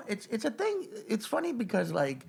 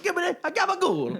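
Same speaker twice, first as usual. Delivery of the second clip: put-on thick Italian-American accent. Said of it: "it's like".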